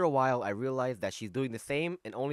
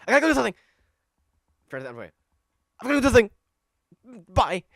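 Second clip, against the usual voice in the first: flustered escape; 10 semitones higher, 9 dB louder, much faster than usual. Acted. Girl flustered, running off.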